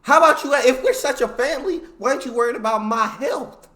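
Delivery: loud, high-pitched mock outrage; heated, incredulous challenge, acted out; indignant confrontation. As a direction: burst out at the boss, then ease slightly. Acting sick worker confronting boss.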